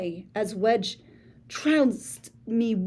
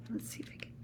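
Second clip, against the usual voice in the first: whisper; 19 dB quieter, much faster than usual. Acted. Quiet aside from character.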